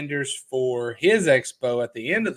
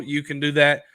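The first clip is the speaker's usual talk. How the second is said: ordinary delivery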